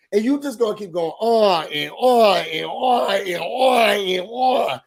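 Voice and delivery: sing-out each repeated "on"; mock someone who never stops talking; exasperated mocking. Fed up she keeps going on, exasperated. Mocks her going on endlessly.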